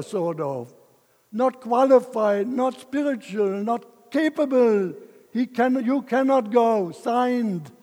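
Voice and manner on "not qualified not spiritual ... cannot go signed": higher, louder mock verdict; mocking the saints' letter. Man sneering at critics who call him unqualified. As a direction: read their judgment with scorn. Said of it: "The saints have given me a letter".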